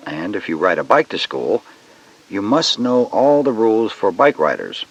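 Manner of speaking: normal talking voice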